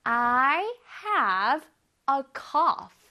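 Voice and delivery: word by word with pauses; slow teacher demo; slow model reading. Shown as a demonstration. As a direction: give each word its own beat. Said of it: "해볼게요".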